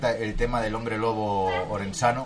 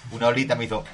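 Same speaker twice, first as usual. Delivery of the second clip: normal talking voice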